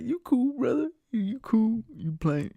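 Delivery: high-pitched mock reassurance; male passenger. Guy brushing off a request to change song, high mock voice. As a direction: imitate the guy, high and insistent. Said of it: "He's like".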